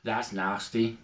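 ordinary talk, nothing marked

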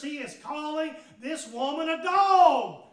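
ordinary delivery

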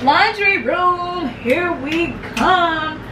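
normal talking voice

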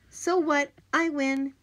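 high, loud victory crow; boy bragging after winning the race; gloating. Acted. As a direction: crow it while dancing. Victory dance taunt.

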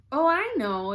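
high, loud exclamation; exclaiming. A narrator's reading. Alice blurts out her idea to the Duchess, exclaiming. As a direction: pipe up with a sudden bright idea. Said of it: "exclaimed Alice".

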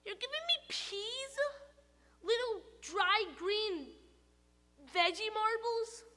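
the tooth protesting vegetables; outraged tooth. Acted.

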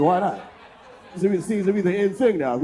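ordinary talk, nothing marked

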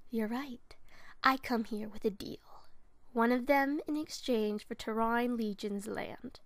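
slow menacing whisper; hushed conspiratorial whisper. Acted. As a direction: breathe the deal out like a secret. Whispered audition take of line.

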